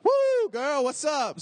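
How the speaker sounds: high, loud excited holler; hyped flirt.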